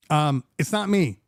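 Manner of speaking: normal talking voice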